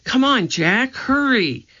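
put-on high girl's voice calling him to hurry; urgent cry. A narrator's reading. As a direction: plead from the doorway. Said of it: "cried Annie".

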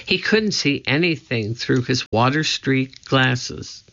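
plain reading voice

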